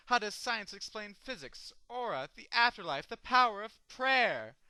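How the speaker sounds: imitating a pert, cocksure believer; pert assertion. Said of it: "Storm pertly asserts".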